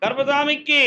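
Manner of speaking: chanted shout; ritual cry. Real, not acted. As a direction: call it out for the crowd to answer. Ritual call and response.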